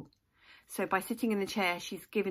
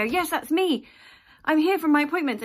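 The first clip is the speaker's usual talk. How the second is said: high, loud, eager reply; eager patient; 9 semitones higher, 11 dB louder than usual. Retold or imitated. Nervous patient jumps up to answer the dentist, eager and loud. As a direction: pipe up loudly, keen to be seen.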